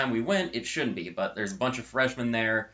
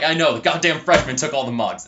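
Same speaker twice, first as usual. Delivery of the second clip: louder, imitated irritated outburst; mock exasperation; 3 semitones higher, 8 dB louder than usual. Friend griping that freshmen took the mugs, imitated exasperation. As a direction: fire back in imitated frustration. Friend's angry retort retold.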